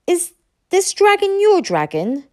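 ask, hoping for a mistake; anxious. A narrator's reading. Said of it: "with uncharacteristic anxiety".

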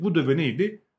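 normal talking voice

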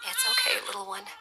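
high soothing coo; calm the baby softly. A narrator's reading. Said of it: "she murmured".

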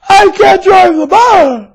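high, slow, shouted cry of a rattled driver; hammed-up high shout of a flustered driver; panicked bus driver. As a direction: wail it like a driver who can't cope.

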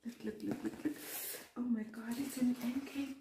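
ordinary talk

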